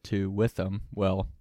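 ordinary delivery

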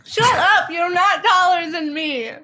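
playful indignant protest. Real, not acted. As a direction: snap back, mock-offended at being called short. Mock quarrel over height.

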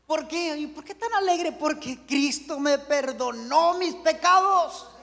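high, loud, building preacher voice; full-force preaching. Acted. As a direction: preach it out to the whole church. (predicación a todo pulmón)

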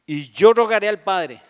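booming scripture line; loud proclamation. Acted. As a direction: proclaim it out over the crowd. Loud preaching quote.

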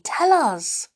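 high, loud eager plea. A narrator's reading. Children press the trees for an answer, eagerly. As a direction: beg eagerly to be told. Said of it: "whispered back the children eagerly".

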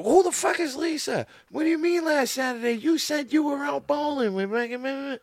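put-on high, loud shrieking jealous girlfriend; furious girl yelling. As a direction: scream it like a girl catching her man cheating. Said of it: "then you hear a girl".

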